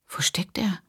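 soft unvoiced whisper to herself; whispered thought. A narrator's reading. Hushed whisper, searching the dark room. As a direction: Rachel whispers, searching for Michael.

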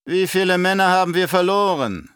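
loud, high-pitched baron's demand; demanding interrogation. A narrator's reading. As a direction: press hard for the figure. Baron interrogates captain about losses.

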